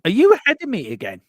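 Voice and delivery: loud, high, falling question; indignant surprise. Real, not acted. Co-host suspects the other's feed is ahead, indignant surprise. Stream out of sync, spoiled play.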